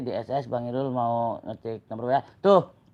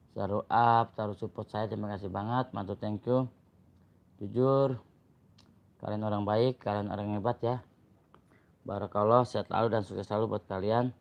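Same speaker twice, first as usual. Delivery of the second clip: normal talking voice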